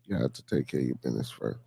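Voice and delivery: very low and soft aside; deep, quiet afterthought; low, hushed repeat. Real, not acted. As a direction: drop right down, as if to yourself. Repeats the motto low, to himself.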